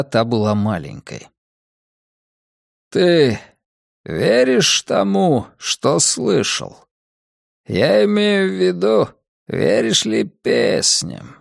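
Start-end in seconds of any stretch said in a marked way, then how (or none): 2.9-11.4 put-on slow, cold old man, higher pitch; a frail, scheming old man, lightly voiced. Old man character speaks.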